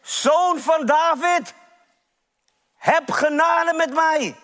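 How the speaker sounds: high, drawn-out call for mercy; preacher acting a beggar's cry. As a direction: call out slowly to someone passing by. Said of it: "hij roept en hij schreeuwt".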